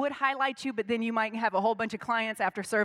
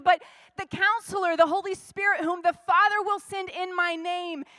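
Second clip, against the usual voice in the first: higher and louder repeat of the verse; preached scripture; 6 semitones higher, 4 dB louder than usual. Acted. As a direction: proclaim it this time.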